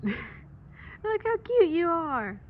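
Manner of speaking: high, slow cooing to a pet. Real, not acted. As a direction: coo lovingly at the cat. Cooing at cat.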